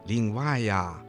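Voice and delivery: ordinary delivery, nothing marked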